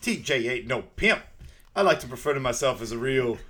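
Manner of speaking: loud, mock-indignant character voice. Friend rejects being called a pimp, loud mock indignation. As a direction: puff up the comic objection, laugh creeping in. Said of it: "He's like".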